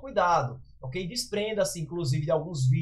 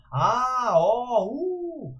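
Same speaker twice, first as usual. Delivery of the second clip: drawn-out oohs and aahs, pitched up; surprised interjections; 5 semitones higher, much slower than usual. Shown as a demonstration. Teacher showing students interjections of surprise and admiration, in drawn-out amazed tones. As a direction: demonstrate exclamations of surprise and wonder. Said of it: "é pra dizer surpresa, espanto, admiração".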